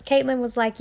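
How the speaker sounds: usual speaking voice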